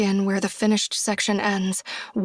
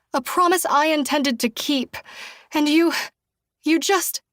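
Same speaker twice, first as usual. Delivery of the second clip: rising anger; 6 semitones higher than usual. A narrator's reading. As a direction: voice climbing, higher and tense.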